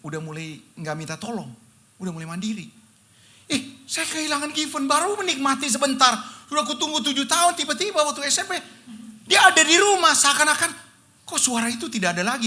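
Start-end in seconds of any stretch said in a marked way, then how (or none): 3.5-12.5 high, loud lament of loss; anguished outcry. Real, not acted. Preacher crying out to the congregation over losing his daughter. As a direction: cry out the grief, louder and higher.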